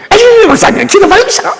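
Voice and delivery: high, coy "아유" from a churchwoman; teasing deaconess. Retold or imitated. Deaconess teasing the pastor as she leaves, high and coy. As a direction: squeal it up high, playfully. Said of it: "그러면서".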